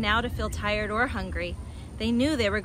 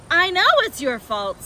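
loud, raised, angry retort; furious; 7 semitones higher, 10 dB louder than usual. A narrator's reading. Badger blaming Fox for the mess, furious. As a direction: snap back in blame. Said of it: "said Badger furiously".

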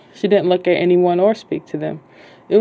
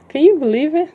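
excited; 9 semitones higher than usual. Acted. Narrator shows off winning ticket.

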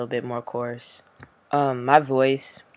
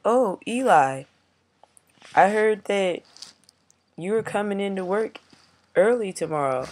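mimicking supervisor; 7 semitones higher, 4 dB louder than usual. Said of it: "my supervisor was like".

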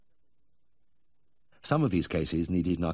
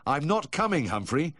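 firm refusal; 9 semitones higher than usual. Acted. Vet refuses caller.

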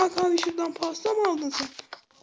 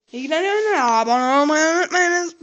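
loud shout; 3 semitones higher, 8 dB louder than usual. Real, not acted. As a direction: yell the sign-off. Shouted sign-off.

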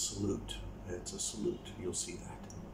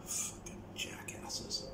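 muttered curse under breath; whispered aside; 12 dB quieter, slower than usual. Real, not acted. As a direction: hiss it quietly to yourself. Aside about the trolls.

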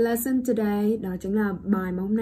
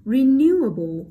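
renewable slowly, NEW punched high and loud; light re, big stretched NEW in renewable; stress on second syllable; 4 semitones higher, 7 dB louder, slower than usual. Shown as a demonstration. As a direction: hit NEW hard in renewable. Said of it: "trọng âm rơi vào âm tiết số hai".

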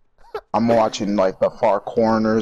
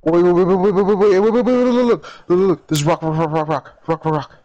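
loud, high, repeated words tumbling out; urgent babbling; 9 semitones higher, 7 dB louder than usual. Real, not acted. Player showing friend a rock, high urgent babble. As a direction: rattle it off in urgent excitement.

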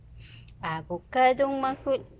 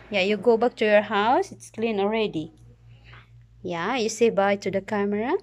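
ordinary talk

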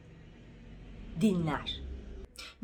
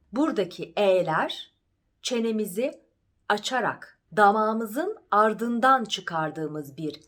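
ordinary talk

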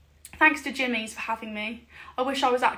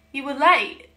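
louder, playfully stern scolding; mock scolding; 7 dB louder than usual. To viewers asking for Hoochie, mock-stern telling-off. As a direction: pretend to tell them off. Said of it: "I'm joking".

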